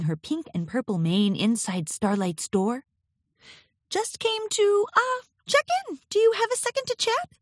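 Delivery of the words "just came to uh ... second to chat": very high, nervous character voice; hesitant, squeaky-high request for a chat; high, nervous Twilight Sparkle. A narrator's reading. Twilight asks Starlight for a chat, high and nervous. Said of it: "Her voice sounded high and nervous".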